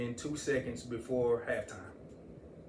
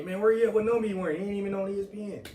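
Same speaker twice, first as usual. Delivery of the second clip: excited friends calling; 6 semitones higher, much faster than usual. Retold or imitated. Friends calling, asking where he is.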